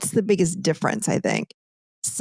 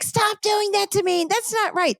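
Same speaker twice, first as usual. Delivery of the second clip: amped-up younger self; 12 semitones higher than usual. Retold or imitated. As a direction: replay her old heated self in a high, pitched-up voice. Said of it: "I'm just not emotionally amped up like I used to be".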